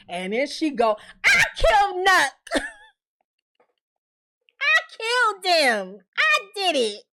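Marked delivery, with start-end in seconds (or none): 1.2-7.0 higher, bold put-on voice; imitated blunt confession. Tiffany boasting to Bakari that she killed him, higher put-on voice. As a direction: own it with a proud snap.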